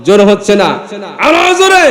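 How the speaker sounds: real rallying shout. Real, not acted. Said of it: "জোরে কন".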